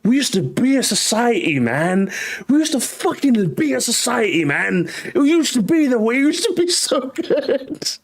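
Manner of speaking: hammed-up anguished plea; mock lament. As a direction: mourn it melodramatically, ending in a laugh. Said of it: "I'm just like, "Man".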